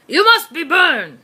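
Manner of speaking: loud, slow bellowing greeting; loud shout. Acted. Brigadier greeting Byrne at the park, shouting slow and loud. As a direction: boom out the name like a parade ground. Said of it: "He shouted in a much louder voice".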